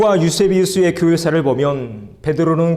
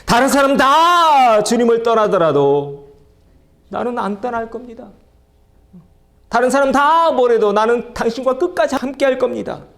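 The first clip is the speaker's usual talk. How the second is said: loud boast; 7 semitones higher, 4 dB louder than usual. Retold or imitated. Peter loudly vows to Jesus he will never leave. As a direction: boast it out loud, full of confidence. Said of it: "그렇게 큰 소리로".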